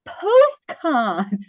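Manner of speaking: high, slow drawn-out "Oh, postcards"; dawning realisation. Retold or imitated. Phone clerk finally understanding, drawn-out high surprise. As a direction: stretch it out as the penny drops. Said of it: "And she goes".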